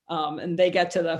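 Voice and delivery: usual speaking voice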